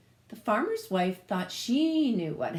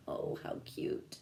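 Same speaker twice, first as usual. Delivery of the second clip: whispered aside; 9 dB quieter than usual. Real, not acted. Teacher reacts to the piglet picture.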